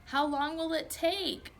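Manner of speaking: high, slow, whiny plea. A narrator's reading. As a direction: drag the question out like a sulky kid. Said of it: "Delee asked in a whiny voice".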